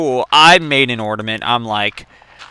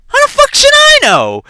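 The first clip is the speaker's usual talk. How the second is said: very high, loud outburst; hammed-up furious comeback; exasperated yell; 6 dB louder than usual. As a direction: throw it back in shrill exasperation. Acted quarrel with sister.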